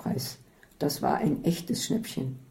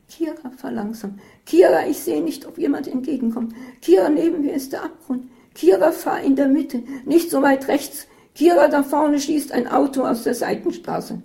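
higher, breathless backseat panic; anxious nagging; 9 semitones higher, 5 dB louder than usual. A narrator's reading. Isabel nagging Katharina at every bend, higher and panicky. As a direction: pester the driver in rising alarm. Nervous passenger nags driver.

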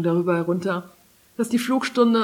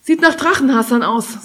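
raised, louder note of alarm; 5 semitones higher, 6 dB louder than usual. A narrator's reading. Maeva warns Kari about the armed riders, alarmed. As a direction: sound the warning, on alert. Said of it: "Sie klang alarmiert".

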